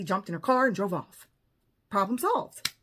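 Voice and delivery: usual speaking voice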